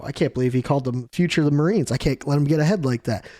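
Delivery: quick envious mutter, barely acted; rushed jealous grumble. Prince Gru fretting over a rival, rapid and jealous. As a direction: rattle it off fast, lightly voiced rival.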